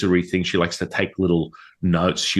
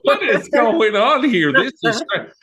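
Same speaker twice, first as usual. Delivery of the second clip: stunned disbelief; 4 dB louder than usual. Retold or imitated. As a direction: gasp it out, completely freaked. Stunned in friend's house.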